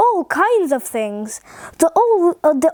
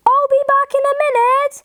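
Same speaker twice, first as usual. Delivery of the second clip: high, quick storybook shout; high-pitched mock shout, rattled off; called back; 10 semitones higher, faster than usual. A narrator's reading. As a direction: call back over a distance without real volume. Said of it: "He stood and shouted back".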